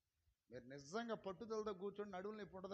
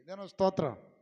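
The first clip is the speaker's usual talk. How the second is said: loud praise call; 16 dB louder than usual. Real, not acted. Preacher calling praise to the congregation, loud.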